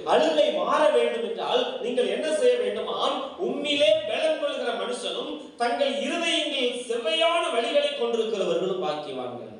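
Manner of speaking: fervent pulpit reading of the verse; preaching a verse. Acted. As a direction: proclaim the scripture to the congregation. Preacher reads verse forcefully.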